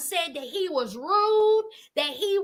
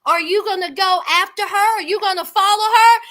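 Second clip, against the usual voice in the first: pushy producers; 7 dB louder, faster than usual. Retold or imitated. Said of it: "The producers said".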